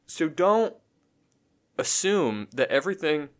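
words broken by a hiccup; hiccuping mid-sentence. Real, not acted. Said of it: "I'm sorry, I got the hiccups".

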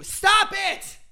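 high, loud shriek of a yell; Tyra screaming. Acted. Host screams at the contestant, full shriek. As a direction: explode at her. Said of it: "went insane".

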